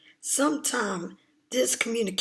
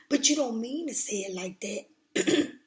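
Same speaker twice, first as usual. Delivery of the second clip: normal talking voice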